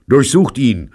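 high, loud, sharp command; snapping. A narrator's reading. Host ordering his men to search the guest, snapping. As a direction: snap out the order. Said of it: "schnappte Robert".

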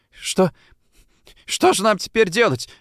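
high, halting question; forced out. A narrator's reading. Boy asking the detective what to do, strained. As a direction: squeeze it out after a false start. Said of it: "выдавил Майкл".

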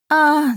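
high, loud moan of pain. A narrator's reading. Heroine hurts her hand punching the dragon, pained moan. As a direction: cry out from a hurt hand. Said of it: "застонала я".

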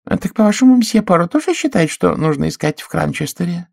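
put-on high, musing old-lady voice; thoughtful. A narrator's reading. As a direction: wonder it aloud. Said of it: "задумчиво сказала мисс Ганнет".

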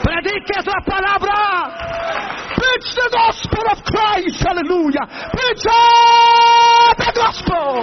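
high, slow, drawn-out exhortation; impassioned preaching. Real, not acted. Preacher charging the congregation after his plane story, fervent cry. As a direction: cry out the charge, stretching each phrase high.

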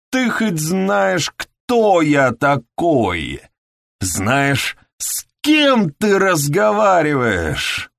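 high, loud, slow fury; furious shout. A narrator's reading. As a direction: roar it with outrage. Furious former inspector shouting.

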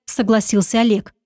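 ordinary delivery, nothing marked

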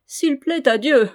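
loud, high, jeering repetition; mocking echo. A narrator's reading. Gueffès repeats his lord's words back, mockingly. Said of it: "répéta Gueffès d'un air goguenard".

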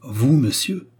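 plain reading voice

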